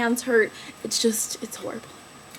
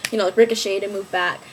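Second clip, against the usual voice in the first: normal talking voice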